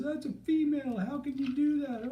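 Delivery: very high mock-appalled bystanders; mock outraged crowd.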